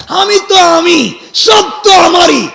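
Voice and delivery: high, slow, drawn-out boasting; self-important boast. Acted. Preacher acting a young man bound abroad, boasting. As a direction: crow it out as if owning everything.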